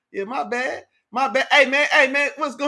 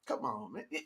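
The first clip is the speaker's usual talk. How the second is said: ordinary delivery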